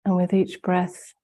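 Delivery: normal talking voice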